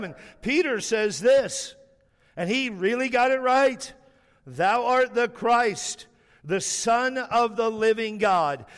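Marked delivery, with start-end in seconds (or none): 4.5-8.7 every word stressed, slow and ringing; emphatic confession. Acted. Preacher quoting Peter's confession of Christ, emphatic. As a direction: proclaim it slowly, leaning on each word. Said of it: "every word here is emphatic".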